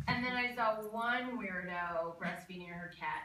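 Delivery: normal talking voice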